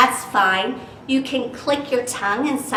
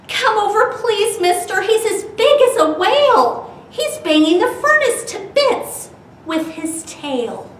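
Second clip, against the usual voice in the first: high, urgent plea on the phone; panicked plea; 10 semitones higher, 5 dB louder than usual. Acted. Boy phones the fish man for help, panicked and high. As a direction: beg for help, frightened.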